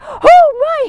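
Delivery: high, loud astonished exclamation; sudden joy at being healed; healed leper amazed at his healing; surprised gasp. Retold or imitated. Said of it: "he's like".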